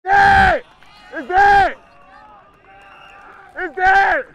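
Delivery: loud call across field; shouting. Real, not acted. Calling the play dead.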